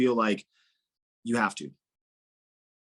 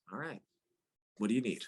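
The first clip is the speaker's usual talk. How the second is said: meek give-in; 3 semitones lower, 8 dB quieter, faster than usual. Retold or imitated. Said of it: "He's like".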